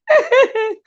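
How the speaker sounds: short burst of very high laughter; high-pitched laugh. Real, not acted. Vlogger tickled by a viewer, high-pitched laugh. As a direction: squeal with laughter. Still cracking up at comment.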